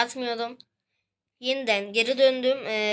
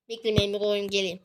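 usual speaking voice

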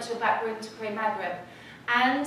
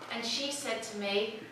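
normal talking voice

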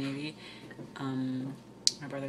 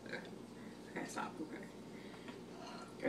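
whisper; 20 dB quieter, much slower than usual. Real, not acted. Quiet countdown before the contest.

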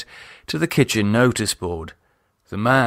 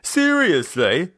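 higher, rough-edged exasperated lad; exasperated Nathan; 8 semitones higher, slower than usual. A narrator's reading. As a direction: plead with her, fed up and rattled. Nathan voiced.

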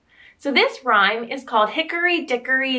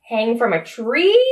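ordinary delivery

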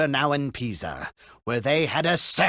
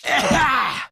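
burst of laughter; 10 semitones higher, 5 dB louder than usual. Real, not acted. Narrator laughs.

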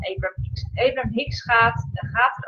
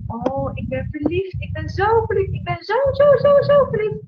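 mock lovestruck gush, climbing much higher and louder; gushing in love; 10 semitones higher, 4 dB louder than usual. Vlogger acting out someone saying they are in love, giddy gushing. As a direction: swoon over "zo, zo", rising into a giddy, dreamy voice. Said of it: "Op het moment dat je zegt van".

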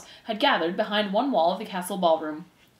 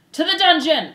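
loud, high cry from a crowd; 9 semitones higher, 9 dB louder than usual. Acted. Voice in the crowd condemns Despereaux, crying out. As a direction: cry out for punishment. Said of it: "a voice cried out".

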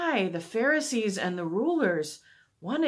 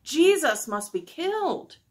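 loud, high demand; crying out; 9 semitones higher, 7 dB louder than usual. A narrator's reading. Rulers demanding a death from Pilate, crying out. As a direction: insist loudly, pitched well up. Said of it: "cried the rulers".

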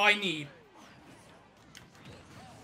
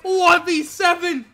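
slow, loud hype announcer; announcer commentary; 7 semitones higher, 6 dB louder, much slower than usual. Acted. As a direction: call the finish like a sportscaster.

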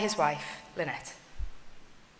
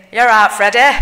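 loud, badly faked Scottish greeting; hammed-up Scottish accent, much louder; awful Scottish accent; 11 dB louder than usual. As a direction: call it out in a terrible Scottish accent. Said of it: "in an awful Scottish accent".

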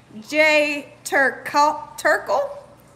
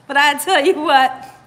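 usual speaking voice

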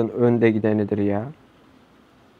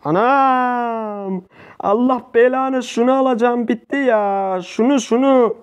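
angry outburst; 6 semitones higher, slower than usual. Real, not acted. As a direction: chase the kill in a rage. Rage outburst chasing kill.

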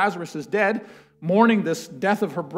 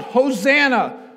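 crowd crying out; 6 semitones higher, 6 dB louder than usual. Acted. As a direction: cry out Hosanna like the welcoming crowd. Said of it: "cried out".

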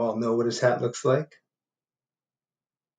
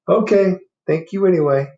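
high, louder, cheerful; squirrel voice; 8 semitones higher, 10 dB louder than usual. Acted. Squirrel thanking the bear, high and cheerful. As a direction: thank him brightly.

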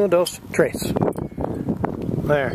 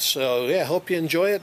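ordinary delivery

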